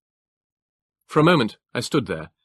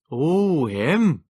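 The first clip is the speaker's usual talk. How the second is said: put-on northeast England accent; 7 semitones higher, 4 dB louder than usual. A narrator's reading. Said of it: "the clipped, Teutonic accent of the northeast of England".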